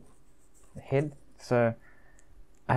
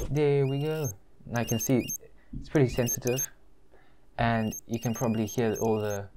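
muffled behind fabric mask; through a face mask. Real, not acted. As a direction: mouth covered by cloth. Seen 2: Full fabric mask covers mouth and nose throughout; absent in R frames.

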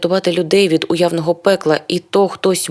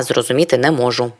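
ordinary delivery

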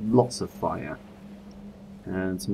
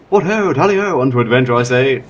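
loud, hammed-up posh British gent; a jolly old English adventurer off on a quest, loud; 5 semitones higher, 11 dB louder than usual. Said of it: "maybe we're not that British".

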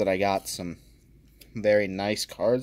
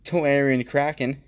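ordinary delivery

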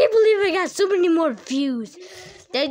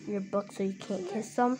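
usual speaking voice

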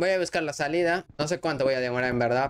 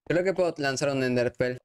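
ordinary delivery

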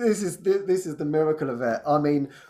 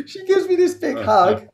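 usual speaking voice

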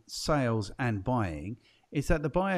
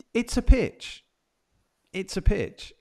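excited; 9 semitones higher, 6 dB louder, slower than usual. Real, not acted. Emphatic realisation.

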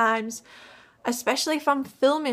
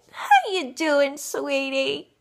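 Harley Quinn voice; 2 semitones higher, slower than usual. Retold or imitated. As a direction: coo it patronisingly. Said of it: "Harley Quinn impression".

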